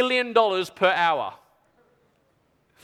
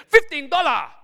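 high, loud outraged echo; appalled shopkeeper; 7 semitones higher, 5 dB louder than usual. Acted. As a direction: squawk the price in mock horror. Seller appalled by low offer.

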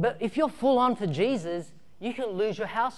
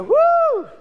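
high, loud whoop of delight; excited whoop; 11 dB louder than usual. Retold or imitated. Kids whooping as the petrol goes up, high and loud. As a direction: cheer as the fire catches.

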